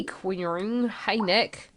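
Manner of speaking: normal talking voice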